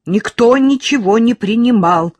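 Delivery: loud, high-pitched firm denial; mother insisting at full volume; loud declaration. A narrator's reading. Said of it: "громко заявила мать".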